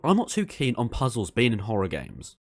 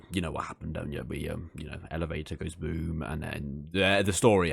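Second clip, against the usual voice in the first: hushed; 8 semitones lower, 6 dB quieter than usual. Acted. Hushed aside about Gregory's fate.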